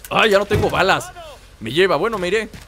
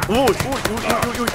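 alarmed yelping; 4 semitones higher than usual. Real, not acted. Under fire in gunfight.

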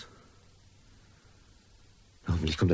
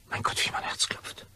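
anxious whisper; 6 dB quieter than usual. Acted. Nervous man waiting, heart pounding.

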